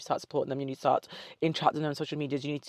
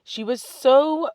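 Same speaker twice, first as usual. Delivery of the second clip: stretched high so; 9 semitones higher, 6 dB louder, much slower than usual. Real, not acted. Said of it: "searching for the word".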